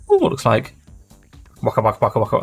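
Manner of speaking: ordinary talk, nothing marked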